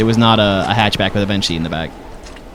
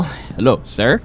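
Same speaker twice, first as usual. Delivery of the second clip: ordinary delivery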